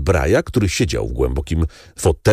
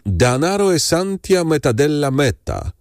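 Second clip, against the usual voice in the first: Italian saying; 10 semitones higher than usual. Acted. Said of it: "jak powiadają Włosi".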